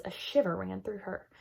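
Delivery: usual speaking voice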